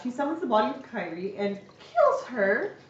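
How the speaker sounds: ordinary talk, nothing marked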